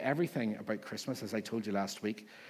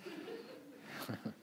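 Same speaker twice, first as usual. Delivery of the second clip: mock laugh; 9 dB quieter than usual. After mock Christmas cheer.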